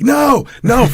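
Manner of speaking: high, loud yell of no, no; retold yell. Coach shouting from the corner so his fighter won't take the foot, high and loud. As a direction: shout the warning across the mat. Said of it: "and, like, yelling".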